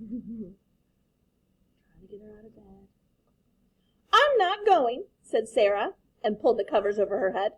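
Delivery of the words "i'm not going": high, loud stubborn refusal; stubborn child. Acted. Daughter refusing her father, high stubborn child voice. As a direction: dig in like a sulky kid.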